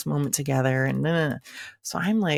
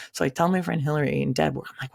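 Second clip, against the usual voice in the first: normal talking voice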